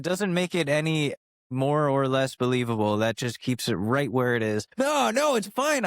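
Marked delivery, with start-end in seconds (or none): 4.8-5.9 mock defensive. Defensive self-reassurance.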